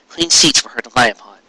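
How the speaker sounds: plain reading voice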